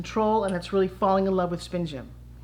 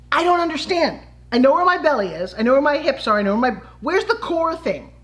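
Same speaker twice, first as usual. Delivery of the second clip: loud, high-pitched bewildered protest; exasperated confusion; 4 semitones higher, 4 dB louder than usual. Retold or imitated. Dance pupil baffled by teacher's core command, exasperated. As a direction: plead your confusion, pitch raised and emphatic. Acts her confused younger self.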